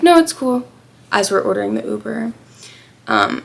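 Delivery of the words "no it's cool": high, loud no it's cool; high nervous brush-off. Retold or imitated. Retold awkward reassurance.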